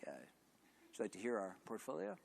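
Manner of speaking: small, soft nervous question; timid asking. Retold or imitated. To the school contact, a meek nervous question. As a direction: shrink into a meek little ask. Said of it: "I'm actually much more cool than I am on the stage".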